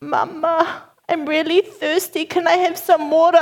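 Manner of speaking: put-on high little child voice; Leah. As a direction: imitate a small child asking sweetly. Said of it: "And then Leah's voice".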